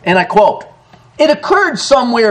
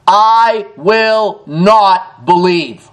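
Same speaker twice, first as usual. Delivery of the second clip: I WILL NOT BELIEVE, very slow, each word stressed; emphatic denial; much slower than usual. Acted. Thomas refusing the other disciples, I WILL NOT BELIEVE in emphatic denial. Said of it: "An emphatic denial".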